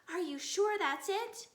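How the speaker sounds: usual speaking voice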